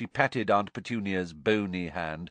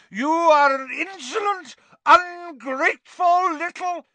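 very high, slow furious shriek; furious Aunt Marge; 7 dB louder than usual. A narrator's reading. Aunt raging at Harry, very high. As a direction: choke on the rage. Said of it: "swelling with fury".

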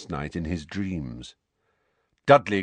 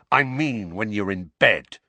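plain reading voice

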